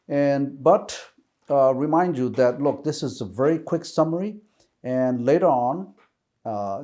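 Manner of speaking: usual speaking voice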